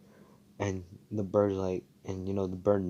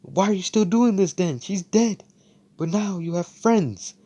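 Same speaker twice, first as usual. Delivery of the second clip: much higher, louder plea; impassioned shout; 12 semitones higher, 6 dB louder than usual. Retold or imitated. Asta pleading with Luck to accept friends, impassioned shout. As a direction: shake him awake with it. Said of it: "He's like".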